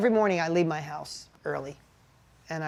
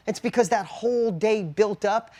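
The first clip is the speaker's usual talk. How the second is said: ordinary delivery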